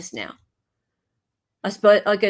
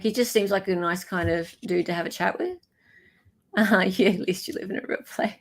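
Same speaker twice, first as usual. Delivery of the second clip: usual speaking voice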